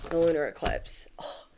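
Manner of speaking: hushed whispered aside. Real, not acted. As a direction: breathe it out in awe. Awed aside about eclipse.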